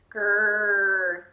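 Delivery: loud bear growl; a pleased growl. A narrator's reading. Old Bear growls over the knitted scarf, a loud growl.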